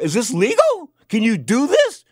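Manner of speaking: loud, high-pitched incredulous questions; retold indignant challenge. Customer asks water lady about legality, retold indignant challenge. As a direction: challenge the seller, acting out the outrage.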